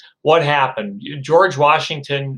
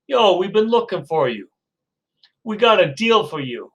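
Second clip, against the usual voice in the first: streetwise seller; 5 semitones higher than usual. Acted. Gun sellers pitch George.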